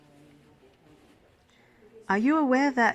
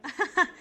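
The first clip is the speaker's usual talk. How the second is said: brief bright laugh rising; light high giggle; 10 semitones higher than usual. Acted. Nurse laughing off the awkward moment, light giggle.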